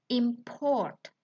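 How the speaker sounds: "import" with the stress on PORT; stress on second syllable. Shown as a demonstration. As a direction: verb form "import", imPORT. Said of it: "It has different stress. And the next".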